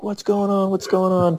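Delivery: high-pitched panicked mimicry of himself; freaking out. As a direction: replay the panic, pitch jumping up. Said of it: "Freaking out".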